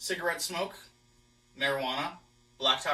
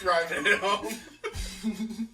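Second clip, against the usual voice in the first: stretched out slowly, laughing; 8 semitones higher, 4 dB louder, much slower than usual. Real, not acted. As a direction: draw it out while cracking up. Joking, laughing.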